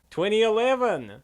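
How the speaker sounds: loud, high, triumphant call of the year; big cheerful shout; excited announcement. Real, not acted. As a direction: proclaim it like a show host.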